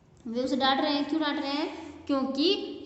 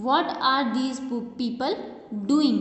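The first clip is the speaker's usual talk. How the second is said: word by word, very slow and clear; slow classroom read-aloud; slow dictation; much slower than usual. Shown as a demonstration. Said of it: "teacher reads sentence aloud".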